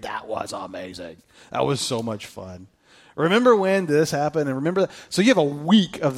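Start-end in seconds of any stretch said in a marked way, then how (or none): 0.0-2.7 soft, slow nostalgic murmur; lower, softer, dreamy remembering; hushed reminiscing. Retold or imitated. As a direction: recall the wedding fondly, quietly. Guests reminiscing about the wedding.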